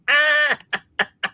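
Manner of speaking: high burst of laughter; real amused cackle. Real, not acted. Laughing at viewer's accent joke.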